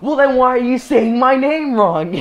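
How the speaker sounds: high, loud retold teacher outburst; angry yelling. Teacher confronting the girl over his name, high angry retold yell. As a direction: act out an exasperated teacher yelling back. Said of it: "yell at me or anything".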